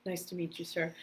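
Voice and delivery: a hushed, timid yes sir to a legend; imitating her starstruck self, soft and small; meek polite reply. Starstruck newcomer greeting Jackie Chan on set, hushed and meek.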